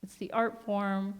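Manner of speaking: ordinary delivery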